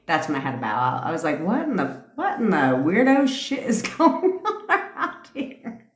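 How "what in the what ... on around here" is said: high, slow, laughing disbelief; cracking up while quoting herself. Retold or imitated. Quoting her own shock at the garage, laughing.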